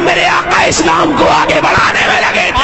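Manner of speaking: high, ringing sermon shout; impassioned high-pitched oratory; full-force preaching. Real, not acted. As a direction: thunder it out to a crowd.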